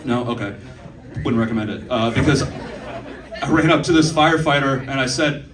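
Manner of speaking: ordinary talk, nothing marked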